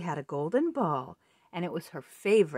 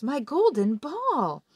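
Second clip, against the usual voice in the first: higher, louder, slow delight; 8 semitones higher, 6 dB louder, slower than usual. Acted. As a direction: gasp with joy at the ball. Princess delighted to see ball.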